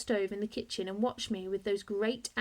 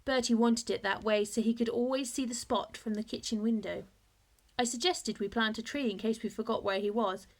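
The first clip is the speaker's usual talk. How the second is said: ordinary delivery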